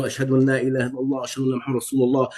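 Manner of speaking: rapid, matter-of-fact recited prayer; quick recitation. Retold or imitated. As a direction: rattle off the Arabic creed fast.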